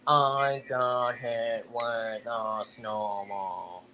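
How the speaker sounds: cartoon character impression. A streamer demonstrating a character, slow and subdued. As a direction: draw every word out slowly and quietly. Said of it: "I can do another person in Plex too".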